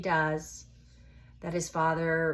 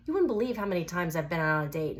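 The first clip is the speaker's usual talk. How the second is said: ordinary delivery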